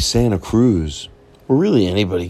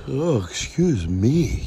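talking through a yawn; 6 semitones higher, much slower than usual. Real, not acted. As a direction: stretch it out in a yawn.

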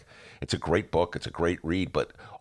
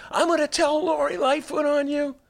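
tattletale whine; 4 dB louder than usual. Retold or imitated. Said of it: "I was like".

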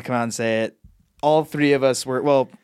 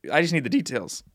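voice cracking; 3 semitones higher, 3 dB quieter than usual. Real, not acted. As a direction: voice cracks on the line. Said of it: "Your voice cracked".

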